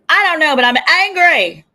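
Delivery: sudden loud angry blurt; loud angry outburst. Real, not acted. Host ranting to viewers, loud angry outburst. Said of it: "Sorry, that's a little loud".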